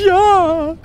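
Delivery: high, tearful ghost voice; sniff, then wail it; sniffling. A narrator's reading. Ghost answering Mathilda through tears, sniffling. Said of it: "schnieft das Gespenst".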